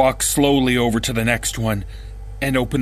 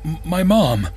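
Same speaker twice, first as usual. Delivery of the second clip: panicked; 6 semitones higher than usual. Acted. Trapped boy realising his mom did this, panicked and rising. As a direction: ask it in disbelief, voice climbing toward fear.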